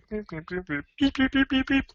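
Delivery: very high beep beep effect, playful; high beeping noises. Acted. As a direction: chirp the beeps way up high. Comic sound effect after voice.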